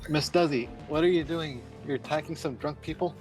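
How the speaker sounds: usual speaking voice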